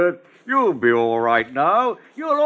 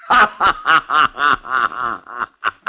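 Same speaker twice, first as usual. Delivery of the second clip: creepy gardener's laugh; sinister laugh. Acted. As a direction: relish the memory with a nasty laugh. Gardener laughs about killing.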